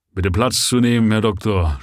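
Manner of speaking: thick-tongued tipsy invitation; slurred, heavy tongue. A narrator's reading. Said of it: "sprach er mit schwerer Zunge".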